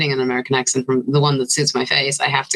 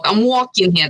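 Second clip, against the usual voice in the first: put-on old-school New York accent; 5 semitones higher than usual. Shown as a demonstration. Actor showing off the most fun accent, Italian-American New York accent. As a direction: call it out like an old-fashioned New Yorker. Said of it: "really old school Italian".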